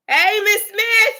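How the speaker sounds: high, slow sing-song hello to a viewer; excited greeting. Real, not acted. Host greeting a viewer on the live, high and slow. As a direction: stretch it out bright and high.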